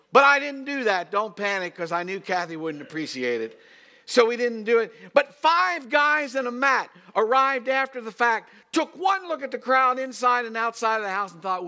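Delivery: high, loud pulpit delivery; full-force preaching. Real, not acted. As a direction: preach it out to the whole room.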